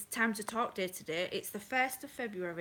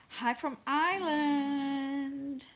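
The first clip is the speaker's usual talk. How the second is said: high, drawn-out American-accented greeting; 6 semitones higher, much slower than usual. Real, not acted. Livestream host greets a viewer from Ireland, American accent. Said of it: "I said it in an American accent".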